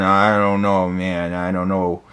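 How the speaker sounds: low, soft reluctant mumble; O J Simpson. Acted. Bully urged to turn himself in, low soft reluctance.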